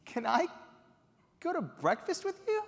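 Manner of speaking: high, pleading call; eager plea. Retold or imitated. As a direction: reach for him with your voice, hopeful. Calling after celebrity, pleading.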